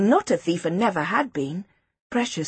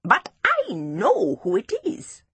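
cross; 5 semitones higher, slower than usual. A narrator's reading. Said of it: "Seppo looked cross".

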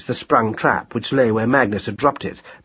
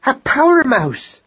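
high, drawn-out cry of realisation; sudden urgent inspiration; excited cry; 12 semitones higher, slower than usual. A narrator's reading. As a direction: cry out as the idea strikes. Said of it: "she cried".

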